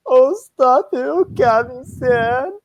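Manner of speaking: bashful high-pitched tease; coy high voice. Acted. Reading a coy character line.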